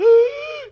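sharp, high, loud gasping for air; winded gasp. Retold or imitated. Cousin winded after a kick to the chest, loud gasp. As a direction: mimic a kid who just got kicked in the chest. Imitating cousin losing his breath.

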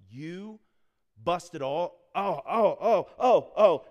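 high, loud wailing oh oh oh, building; mother horrified as the truth dawns; shocked mom wailing. Retold or imitated. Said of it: "She's, like, doing that".

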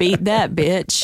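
loud, laughing put-on American accent. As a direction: blurt it out in a brash American accent, cracking up. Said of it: "In my best American accent".

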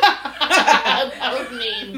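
slow words through laughter; laughing. Real, not acted. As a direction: laugh while talking. Laughing at the story.

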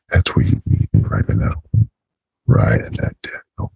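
hushed sultry whisper. Acted.

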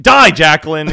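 loud mock shout from an angry chat; mock hateful shout. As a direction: shout it like a furious chatter. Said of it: "they're like".

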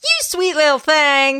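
put-on high old Western woman; old woman ghost. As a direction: croon like an old ghost lady. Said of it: "a Western old woman".

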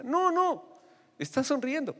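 put-on high, bright reassurance to a scared boy; father's voice. Father coaxing hiding son, high and reassuring.

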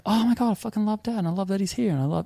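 high, fast gushing; high fast delighted gushing; excited high voice. Retold or imitated. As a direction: gush it out high and quick. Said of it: "And I was like".

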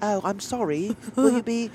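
high-voiced British girl; mock British accent. Girl slipping back into character, high mock-British accent. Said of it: "She's like".

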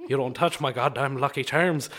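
warning in an Irish brogue; Irish accent. Acted. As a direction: play a threatening Irishman. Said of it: "And that's my Irishman".

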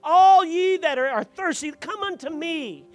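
Jesus standing up at the feast, loud proclamation; proclaiming. Acted. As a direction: cry it out to the whole feast. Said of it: "and he says".

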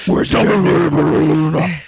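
mock Batman voice, played for laughs; silly Batman growl. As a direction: bark it like a hammed-up Batman. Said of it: "silly Batman voice".

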